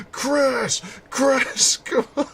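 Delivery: high mock yells of Chris breaking into a laugh; imitating Sheva yelling. Annoyed partner keeps calling Chris, giggling. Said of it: "She yells that at night".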